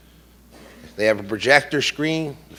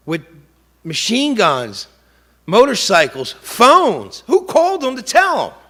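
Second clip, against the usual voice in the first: outraged disbelief; 10 semitones higher, 6 dB louder than usual. Real, not acted. Father exposing the ambush setup, rising in outrage. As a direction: voice climbing high and loud.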